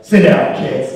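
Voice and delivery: loud, barked order as a stern Batman; bossing kids around like a stern Batman; mean Batman. Retold or imitated. Party Batman orders the kids to sit, barking loud. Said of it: "you can be really authoritative and mean".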